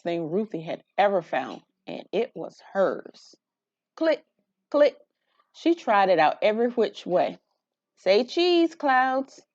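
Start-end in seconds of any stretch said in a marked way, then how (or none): 3.9-5.0 crisp little click, click; camera click sound. Acted. Camera clicks voiced by reader. 8.0-9.4 high, bright call to the clouds; playful photo call. Acted. Child playfully photographing things.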